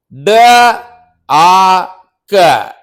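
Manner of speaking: letters spelled out slowly, one by one; slow spelling. Shown as a demonstration. Teacher spelling a word out slowly for kids. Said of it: "we will divide doctor".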